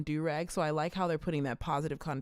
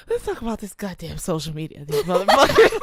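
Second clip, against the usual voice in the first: higher, louder, laughing hard; laughing fit; 5 semitones higher, 12 dB louder than usual. Real, not acted. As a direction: laugh until tears. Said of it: "I'm crying".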